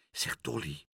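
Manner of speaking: soft, hushed secretive voice; whispered. A narrator's reading. Aagje secretly addressing the pigeon, hushed whisper. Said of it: "fluisterde Aagje".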